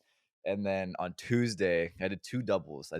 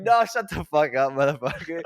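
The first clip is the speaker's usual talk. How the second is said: mock retort; 8 semitones higher, 8 dB louder than usual. Mock retort to coworker's joke.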